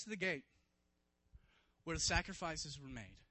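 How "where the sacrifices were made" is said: ordinary talk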